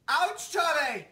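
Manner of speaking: high whiny mock cry; mock crying child. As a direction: cry like a bitten little kid. Charlie bit me parody.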